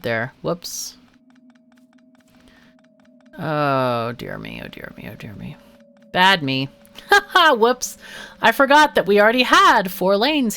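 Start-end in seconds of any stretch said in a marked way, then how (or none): 3.4-5.6 mock dismay. Scolding himself after a mistake. 7.0-7.5 nervous laugh. Real, not acted. Laughs at own blunder.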